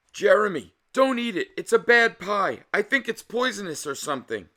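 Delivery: high, loud, urgent plea; frantic high shout of warning; panicked warning. Acted. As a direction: cry out in panic to stop him. Panicked warning to friend at table.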